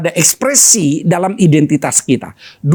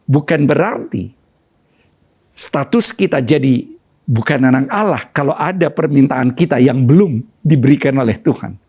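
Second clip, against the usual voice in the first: normal talking voice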